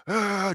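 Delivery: high-pitched screamed "ah", held back in volume; primal scream demo. Shown as a demonstration. Man driving around in his car letting go, primal screaming. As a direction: demonstrate a primal scream without full force. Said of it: "scream, do primal screaming".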